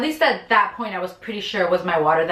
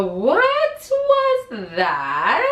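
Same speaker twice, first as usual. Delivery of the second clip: startled question; 6 semitones higher, much slower than usual. Retold or imitated. Confused at sudden gush.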